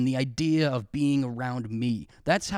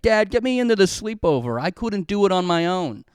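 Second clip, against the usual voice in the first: mock whiny kid; 5 semitones higher, 5 dB louder than usual.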